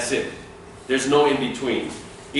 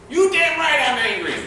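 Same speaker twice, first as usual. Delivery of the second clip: pitched-up hammed-up angry retort; high indignant comeback; mock angry; 12 semitones higher than usual. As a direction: snap it back like an irritated client. Angry client in a role-play.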